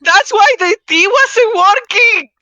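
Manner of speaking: high, loud excited discovery; excited realization. Real, not acted. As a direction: shout the realization in delight. Sudden realization, laughing shout.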